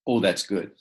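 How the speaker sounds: ordinary talk, nothing marked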